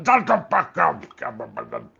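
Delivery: high, loud barking shut up; hammed-up unhinged yell; Mister Kill impression. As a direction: snap at Kevin in a manic character voice. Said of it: "in that Mister Kill mode".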